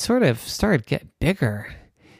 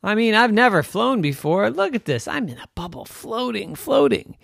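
put-on delighted child; 8 semitones higher than usual. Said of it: "she thought".

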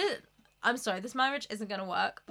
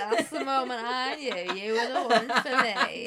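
louder, laughing mock declaration; mock swoon; 6 dB louder than usual. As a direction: ham up a swooning lover.

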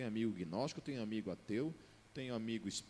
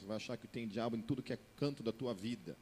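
ordinary talk, nothing marked

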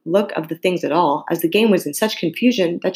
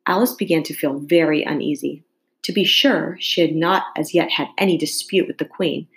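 usual speaking voice

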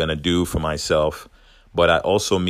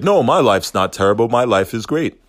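forced cheerful denial; 5 semitones higher, 6 dB louder than usual. Retold or imitated. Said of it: "replace it with".

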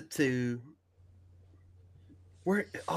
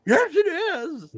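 high, drawn-out silly protest; Batman; 14 semitones higher, 4 dB louder, much slower than usual. Retold or imitated. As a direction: insist in a high put-on voice. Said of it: "Batman trying to find his voice".

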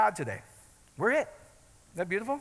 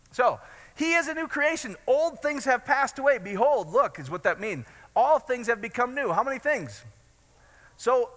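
higher, louder, slower in bursts; preaching at full force; 7 semitones higher, 3 dB louder than usual. Acted. Pastor rousing the congregation, full-force preaching. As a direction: preach it out to the whole room.

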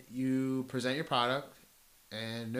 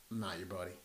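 mock gruff reply; 7 semitones lower, 15 dB quieter, faster than usual. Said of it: "He goes".

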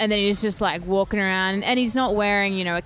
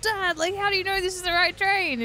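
much higher-pitched mock kid voice; amazed child; 12 semitones higher than usual. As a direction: ask Dad in high-pitched wonder, as a kid. Acts out amazed child asking dad.

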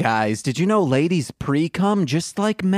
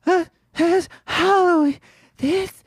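squeaky high voice; 14 semitones higher than usual. Pretend. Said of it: "I'm gonna do, like, a Betty Boop version".

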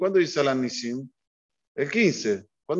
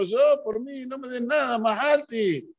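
higher, gentle refusal; humble old rabbi; 8 semitones higher than usual. Retold or imitated. Rabbi telling the family he wants nothing, higher gentle refusal. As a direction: decline the money kindly.

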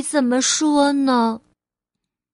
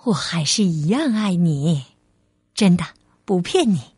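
low, tender motherly voice; calm, lower-pitched mum; loving mother, reassuring; 6 semitones lower than usual. Acted. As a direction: reassure your little one sincerely.

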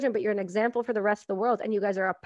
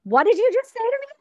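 shrill fast baffled squeal, much higher and louder; baffled outsider; 12 semitones higher, 6 dB louder, faster than usual. Retold or imitated. Hearing a thick Louisiana accent, baffled high squeal. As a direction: squeak it in total disbelief. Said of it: "you go down to Baton Rouge".

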